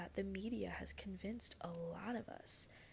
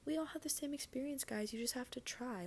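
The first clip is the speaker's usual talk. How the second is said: higher-pitched mock cheerleading voice; put-on higher voice of glib encouragement; mock pep talk; 6 semitones higher than usual. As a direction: parody a chirpy motivational line, pitched well up. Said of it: "So I don't wanna come on here and say".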